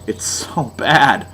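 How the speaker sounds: low, slow groan of dismay; quiet, slow mock despair. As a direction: sink into dread, slowly. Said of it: "and you're like".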